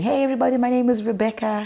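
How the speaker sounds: young host greeting the crowd on stage, a hint of announcer. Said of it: "on stage going".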